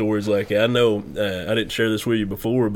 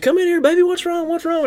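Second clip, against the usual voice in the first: very high-pitched mock mother voice; worried mom; 3 dB louder than usual. Friend's mom calling in a screaming child, imitated worry. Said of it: "his mom heard me screaming".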